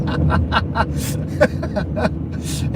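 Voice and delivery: high breathless laugh; laughing fit. Real, not acted. Streamer laughs at a friend's crayon jibe, high breathless laughter.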